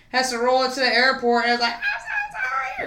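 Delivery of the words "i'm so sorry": much higher wailing apology; mock crying. Mom apologizing at the airport, high mock crying. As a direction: wail it like crying. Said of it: "and crying".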